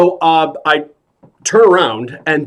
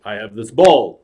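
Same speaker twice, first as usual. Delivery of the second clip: low, falling imitation of the patter; Robert De Niro; 4 semitones lower than usual. Actor tries the clown's trick, low-voiced impression. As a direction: drop the line low at the end. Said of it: "So now he tries it".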